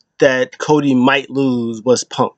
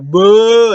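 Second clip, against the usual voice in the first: higher, louder drawn boo; mock crowd boo; 8 semitones higher, 5 dB louder than usual. Crowd booing Logan Paul, mock crowd boo. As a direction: boo like the whole arena.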